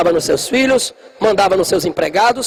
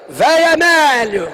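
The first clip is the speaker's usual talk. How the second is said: drawn-out mock call to grandpa, a bit higher and slow; son calling out; 5 semitones higher, slower than usual. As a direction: stretch it out like a cheeky grandson teasing. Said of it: "o meu filho já vai gritando". (neto chamando o avô de brincadeira, devagar)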